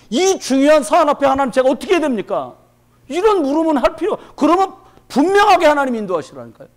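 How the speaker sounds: loud, high, impassioned sermon delivery; fervent preaching. Acted. Pastor urging the congregation toward the shoes of peace, fervent loud preaching. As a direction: preach it out, rising with fire.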